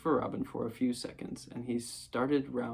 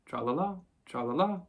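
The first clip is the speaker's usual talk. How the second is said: light, careless little refrain; 4 semitones higher than usual. A narrator's reading. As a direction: toss it off without a care. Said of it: "Piglet, carelessly".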